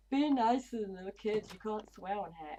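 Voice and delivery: lightly voiced stern scold; mock scolding. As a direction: play the scolding moderator. Quoting being told off.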